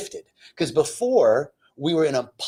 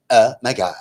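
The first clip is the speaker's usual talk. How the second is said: put-on louder, affected skit-like girlfriend voice; mock neighbor talk; 2 semitones lower, 7 dB louder than usual. Mocking the neighbor chatting with her friend, affected. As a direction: imitate a vapid gossiping neighbor. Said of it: "they're literally talking like this".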